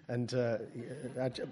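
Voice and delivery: normal talking voice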